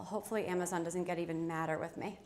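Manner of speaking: ordinary talk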